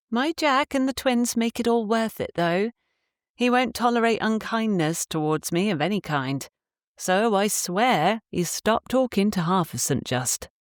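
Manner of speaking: a hint of a proud, affectionate wife; fond wife voice. A narrator's reading. Wife to Poppy about her husband, lightly voiced and fond. Missus Trevethan speaks of husband.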